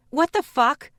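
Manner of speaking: high, loud furious shout. A narrator's reading. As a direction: yell it in shock. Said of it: "I shouted".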